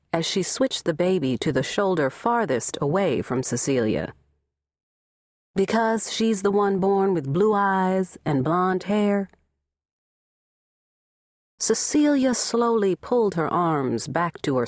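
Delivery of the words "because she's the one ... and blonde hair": ordinary delivery, nothing marked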